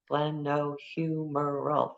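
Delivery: Glenohumeral stretched out slowly syllable by syllable; slow syllable demo. Shown as a demonstration. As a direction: count the syllables as you say it. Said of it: "Glenohumeral is three syllables".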